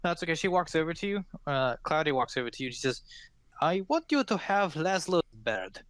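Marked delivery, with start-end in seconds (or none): 3.6-5.8 Cloudy's voice. Acted. GM voices Cloudy giving gift.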